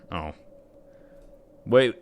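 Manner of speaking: ordinary delivery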